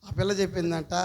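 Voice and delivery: ordinary talk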